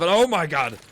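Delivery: sudden loud, high outburst; excited shout. Real, not acted. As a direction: erupt at the big play. Caster erupts at big play.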